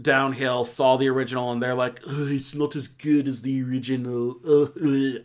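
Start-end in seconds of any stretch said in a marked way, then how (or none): 2.1-5.2 put-on part French accent. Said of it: "I went part French there".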